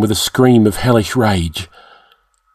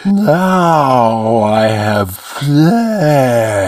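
very slow, thick creature voice, less raised; slobbering monster, quieter; 3 semitones higher, much slower than usual. A narrator's reading. Demon repeating its gloat to itself, slow and thick. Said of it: "the words slobbered thickly".

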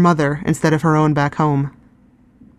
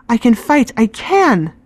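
put-on insistent girl; 7 semitones higher, slower than usual. A narrator's reading. Reka insists she can fight.

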